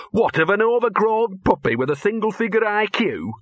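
high-pitched scornful retort; sneering. Acted. Gaspode rejecting the jealousy charge, high scornful sneer. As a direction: pitch it up in disdain. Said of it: "sneered Gaspode".

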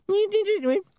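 very high squeaky nipping; recording stuttering; high imp glitch chatter; imp skipping noise. A narrator's reading.

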